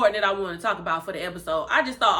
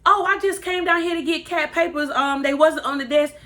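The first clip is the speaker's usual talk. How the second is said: mock innocence; 8 semitones higher, 3 dB louder than usual. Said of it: "Deanna like".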